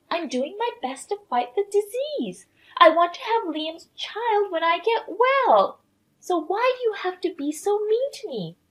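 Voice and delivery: high-pitched, wounded self-defence; hurt protest. Acted. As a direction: plead your case as the one being picked on. Camilla defends herself, feeling attacked.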